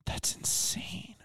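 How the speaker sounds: soft whisper, no voice. Real, not acted. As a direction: breathe it as a whisper. Quiet reaction to calorie count.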